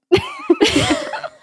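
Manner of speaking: high, squealing real laugh; helpless high-pitched laughter. Real, not acted. Reacting to the story's turn.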